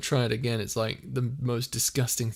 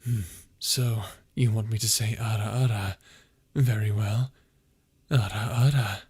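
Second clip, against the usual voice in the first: put-on character voice; 2 semitones lower than usual. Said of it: "could I have servo say ara ara".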